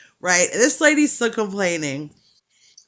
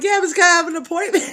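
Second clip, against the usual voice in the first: high, fast mock reply; 10 semitones higher, 4 dB louder, much faster than usual. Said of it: "And I'm like".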